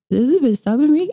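high, loud disbelief; mock outrage at the caption; incredulous. Incredulous inner thought retold.

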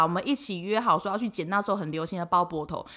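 usual speaking voice

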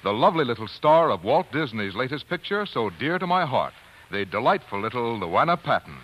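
normal talking voice

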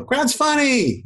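very high, put-on endorser voice; high-pitched parody of a satisfied customer; mock testimonial. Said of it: "Maybe I'll get a testimonial".

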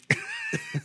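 shrieking giggle; high squeal of laughter. Real, not acted. Laughing after the joke.

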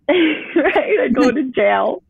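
a high, laughing "right?"; giggling through "right?". Real, not acted. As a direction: laugh the word out. Laughing at her own jail story.

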